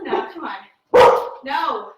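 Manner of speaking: loud, drawn out scolding of the dog. Real, not acted. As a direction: call out to stop the dog.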